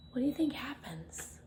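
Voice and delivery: breathy hushed question; whisper. Shown as a demonstration. Reader whispering a question to the listening children.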